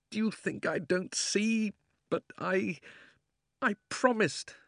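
dry, strained snap; weary parched voice; dried-up voice. A narrator's reading. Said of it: "still in the same voice".

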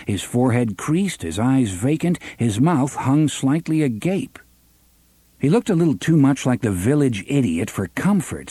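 plain reading voice

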